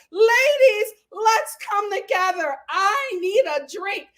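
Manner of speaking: a lightly voiced peacemaker calling out; imitating Marisol. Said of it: "Marisol is standing up with the receipts like".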